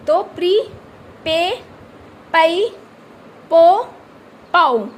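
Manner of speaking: प्री, पे, पई, पो, पाऊ called out loud, each on its own; teacher sounding out syllables. Shown as a demonstration. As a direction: drill each syllable with a pause after it. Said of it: "इसको पढ़ के बताती हूँ".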